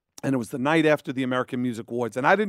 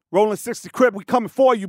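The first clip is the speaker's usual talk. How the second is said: gang caller threat; 6 semitones higher, 3 dB louder than usual. Retold or imitated. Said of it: "Caller, who's this?".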